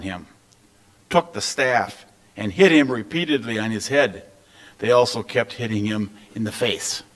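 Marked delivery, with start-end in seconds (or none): none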